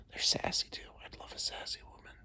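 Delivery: hushed whisper, breathy and secret; conspiratorial whisper. Real, not acted. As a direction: whisper it like a confession. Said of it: "I don't know why I'm whispering".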